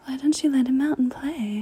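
ordinary talk

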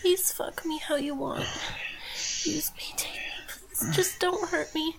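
breathless, unvoiced begging; frightened whispered begging. Captive whispers her begging to the threatening father, frightened. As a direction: whisper the begging, scared and small.

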